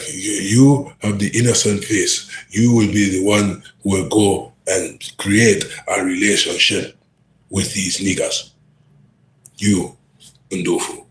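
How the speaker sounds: an African boss assigning the job, mock accent. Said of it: "That's my best African accent right now".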